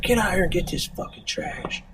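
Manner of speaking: high-pitched imitation of a yelling dad; mock angry yell. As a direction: order the kids out, half-yelled. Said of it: "He started yelling at his kids".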